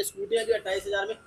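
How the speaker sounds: ordinary talk, nothing marked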